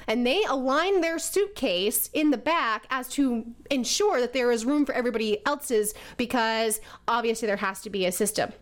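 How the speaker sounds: usual speaking voice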